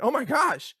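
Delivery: high, loud mock amazement; mock shock. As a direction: act out an aghast spectator. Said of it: "You're like".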